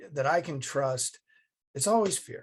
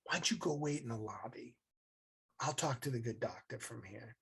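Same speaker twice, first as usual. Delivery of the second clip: mom's quiet voice; 2 semitones lower, 10 dB quieter, faster than usual. Retold or imitated. Mom sends her son out to the lobby, softly. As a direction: say it quietly, sending him away.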